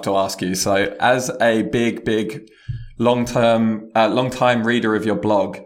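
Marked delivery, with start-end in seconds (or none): none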